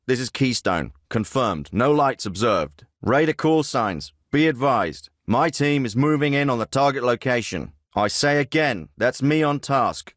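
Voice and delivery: ordinary talk, nothing marked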